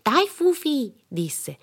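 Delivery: put-on high, eager child voice; bright-eyed kid calling her playmate; playful little girl. A narrator's reading. Child urging dog to play.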